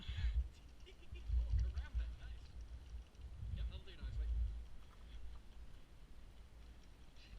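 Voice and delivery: airy drawn-out laugh; long breathy laugh. Real, not acted. Marshal having fun.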